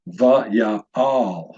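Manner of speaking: vaya'al said slowly, split into va, ya, al; syllable-by-syllable demonstration. Shown as a demonstration. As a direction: sound out vaya'al as three separate syllables. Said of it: "This word would be pronounced".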